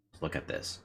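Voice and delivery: unvoiced murmur to self; whispering. Real, not acted. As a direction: whisper it under your breath. Whispers to self while playing.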